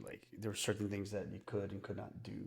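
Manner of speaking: hushed, whispered aside. Real, not acted. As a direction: let it drop to a whisper. Whispered aside about strict rules.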